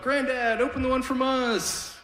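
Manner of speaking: put-on high, sweet girl voice; bright young granddaughter coaxing. Granddaughter urging grandpa to open the gift, high girlish voice.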